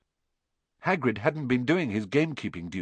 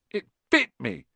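high-pitched indignant complaint; 12 semitones higher than usual. Acted. Ron showing friends his bitten hand, indignant. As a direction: complain in outrage on a raised pitch.

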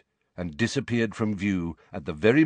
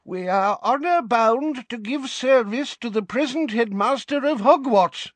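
put-on high, frail old man's cry; frail old wizard crying out; 4 dB louder than usual. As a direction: cry out with fragile indignation. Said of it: "cried a frail-looking old wizard".

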